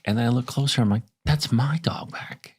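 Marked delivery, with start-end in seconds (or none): 1.2-2.5 whisper. Retold or imitated. Seeing her dog on set.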